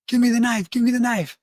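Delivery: high, insistent imitated demand; urgent demand. Drunk man wants his knife back, retold as agitated. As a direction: act out a worked-up drunk, pitched high.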